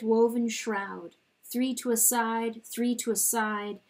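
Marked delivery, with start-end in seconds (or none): none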